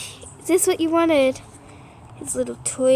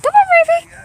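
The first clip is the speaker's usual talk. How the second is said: much higher, louder call to the dog; squeaky come-here for a puppy; high pet-talk voice; 13 semitones higher, 6 dB louder, much faster than usual. Real, not acted. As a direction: coax the dog in a high sing-song.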